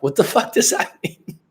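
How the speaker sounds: high incredulous question, close to laughing; baffled. Retold or imitated. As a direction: react thrown by a backhanded compliment. Retold reaction to backhanded compliment.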